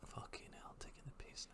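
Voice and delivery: cursing in a whisper; whispered swearing. Real, not acted. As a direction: breathe the curses out unvoiced. Muttering frustration about own body.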